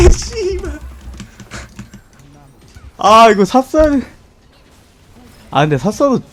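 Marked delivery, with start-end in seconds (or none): 0.1-0.8 high frustrated curse. Real, not acted. Curse after the pentakill. 3.0-4.1 loud regretful groan. Real, not acted. Lament after the loss.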